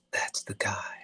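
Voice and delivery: hushed, whispered imitation of a director; awed discovery. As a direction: whisper it as a sudden find. Director suddenly finds the right actor.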